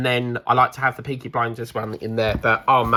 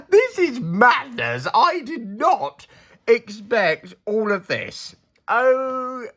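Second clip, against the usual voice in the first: surprised; 8 semitones higher, slower than usual. Real, not acted. Shocked by gift haul.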